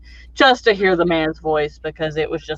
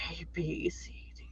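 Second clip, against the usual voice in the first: soft, then unvoiced whisper on the letters; whispered scale; 20 dB quieter than usual. The bass singer teasing the crowd, whispering his scale. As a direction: drop to a bare whisper. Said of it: "And he's like".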